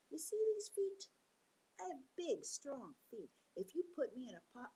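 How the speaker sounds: high cocky animal voice; boastful Turtle. Acted. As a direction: Turtle puffing himself up to bluff. Turtle boasts to trick the wolves.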